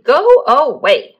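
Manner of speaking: slow, loud grumpy dismissal; storybook grump snapping loudly; snapped. A narrator's reading. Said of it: "snapped Mister Grumpy".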